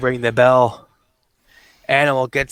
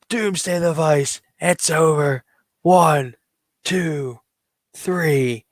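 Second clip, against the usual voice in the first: higher-pitched wrestling call of the climax; excited play-by-play; 5 semitones higher than usual. Pretend. Commentator calling Doomsday Device finish, excited higher play-by-play. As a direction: build excitement towards the finishing move.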